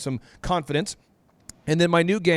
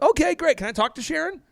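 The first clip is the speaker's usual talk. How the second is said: chirpy salesman on the phone, a touch higher; a lightly voiced eager cold caller; upbeat cold-call voice; 12 semitones higher than usual. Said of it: "I'm like".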